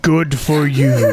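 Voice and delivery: slow, drawn-out dragon retort; heavy, dragging creature voice; booming dragon. Acted. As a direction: say it slowly as an unimpressed dragon. Game master answers as the dragon.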